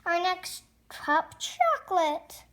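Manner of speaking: normal talking voice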